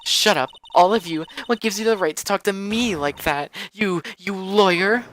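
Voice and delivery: put-on high, indignant young woman's voice; haughty witness, outraged. Miss May turning on the lawyers, high and outraged. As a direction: tell them off in a high, loud-edged voice.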